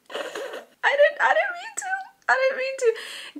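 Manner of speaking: high, loud laughing protest; giggly squeal. Real, not acted. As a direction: squeal it through giggles at your own slip. Laughs at her own accidental pun.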